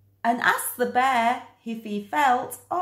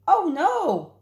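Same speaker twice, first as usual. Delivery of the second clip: high, startled oh no; shocked little exclamation; surprised cry; 8 semitones higher than usual. Acted. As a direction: gasp it out in alarm. Dog reacts to Fred in bin.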